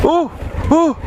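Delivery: high yelps of alarm; startled oh. Real, not acted. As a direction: yelp as the bike nearly goes. Nearly crashes the motorbike.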